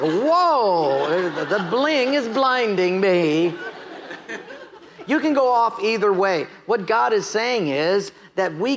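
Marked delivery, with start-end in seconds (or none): 0.0-3.5 mock dazzled. Acts onlooker dazzled by bling.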